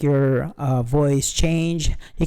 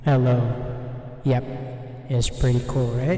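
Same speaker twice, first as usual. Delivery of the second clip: reverb effect; 3 semitones lower than usual. Shown as a demonstration. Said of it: "This is a ballet".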